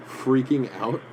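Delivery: ordinary talk, nothing marked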